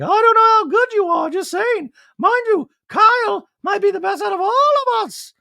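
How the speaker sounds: very high, loud fluty voice; Dorit impression. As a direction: squeak it like a posh socialite.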